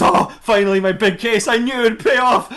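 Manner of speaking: high, excited comic outburst; triumphant lawyer. Acted. Mermaid lawyer bursting in for his big case, triumphant.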